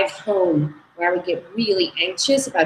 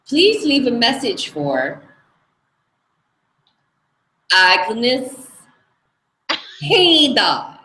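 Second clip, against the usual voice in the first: Hungarian accent impression; 4 semitones higher, slower than usual. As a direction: imitate a Hungarian mother-in-law reading her voicemail, each word spaced out. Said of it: "I'm gonna do her accent".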